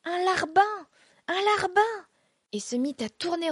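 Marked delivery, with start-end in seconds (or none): 0.0-2.0 shouted high and loud, a mocking jeering chant; hurlement moqueur. A narrator's reading. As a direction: jeer it out at full voice like a rowdy crowd. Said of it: "poussa un hurlement". (huée moqueuse, criée très fort et aigu)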